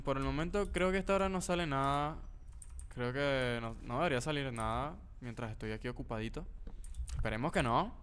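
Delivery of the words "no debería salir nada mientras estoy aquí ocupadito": normal talking voice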